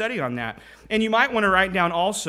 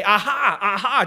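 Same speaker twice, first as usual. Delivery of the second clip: loud, higher gloating "aha"; mocking onlookers; 4 semitones higher, 5 dB louder than usual. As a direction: jeer as if catching someone out. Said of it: "others that look at him and they say".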